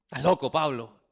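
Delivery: ordinary talk, nothing marked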